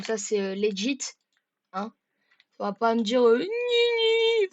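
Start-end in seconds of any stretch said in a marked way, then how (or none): 3.4-4.5 high, slow whimper; mock whine. Player whining at an imagined accuser. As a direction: whine it like a child.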